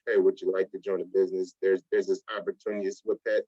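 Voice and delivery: lightly voiced hesitant pitch; unconfident pitch. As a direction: mimic a needy, unsure recruiter. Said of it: "You don't sound confident".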